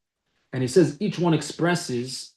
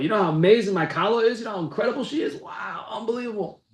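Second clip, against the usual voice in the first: higher, excited boasting with a breathy wow; gushing about his bride; 6 semitones higher than usual. Retold or imitated. Groom gushing to friends about his bride, high and excited. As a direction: rave about her like a smitten groom. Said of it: "We should continue to speak that way".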